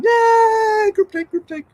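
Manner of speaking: high-pitched little cheer; mock cheer. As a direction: squeal it in playful celebration. Mock cheer for group take.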